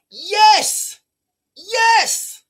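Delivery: thrilled that a viewer got it; excited yes. Real, not acted. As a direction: burst out in delighted agreement. Excited agreement with a chat comment.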